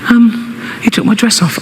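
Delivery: a hint of a six-year-old; little girl voice. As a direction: daughter tells her father what happened, childlike. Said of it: "She's only six".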